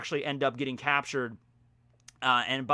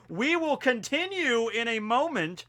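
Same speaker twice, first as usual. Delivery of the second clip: mock announcer; 8 semitones higher, slower than usual.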